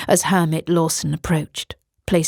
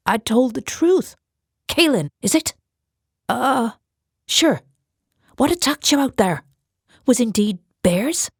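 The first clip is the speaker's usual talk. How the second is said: much higher character voice; lightly voiced high questioner; 7 semitones higher than usual. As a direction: keep the stranger's probing high. Stranger questions the boy.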